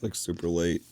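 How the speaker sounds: usual speaking voice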